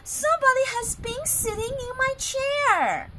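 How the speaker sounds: high, loud indignant mama bear; an offended mother bear; Mother Bear's medium voice. Acted. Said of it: "said in her medium-sized voice".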